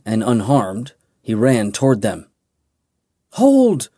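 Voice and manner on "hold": a high, loud "Hold!"; commanding shout. A narrator's reading. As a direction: bark the order to stop. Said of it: "she shouted".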